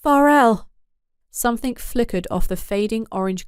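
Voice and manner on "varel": higher, louder cry of his name; urgent call. Acted. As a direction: call out to stop him. Uni calls out during the fight.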